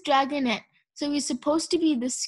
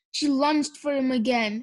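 ordinary delivery, nothing marked

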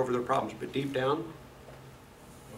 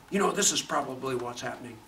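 lower, softer voiced thought; low, understated thinking aloud; imagined musing; 3 semitones lower, 6 dB quieter than usual. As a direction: muse it quietly as someone's private guess. Somebody's guessed thought, voiced.

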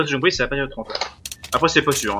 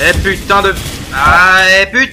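loud stretched frustrated swear; angry outburst; 5 semitones higher, 8 dB louder, slower than usual. Real, not acted. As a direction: blurt it out, furious. Sudden frustration in game.

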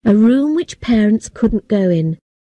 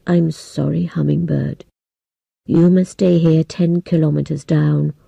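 put-on old man voice; 4 semitones lower than usual. A narrator's reading. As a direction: speak as a solemn old man. Old man speaking gravely.

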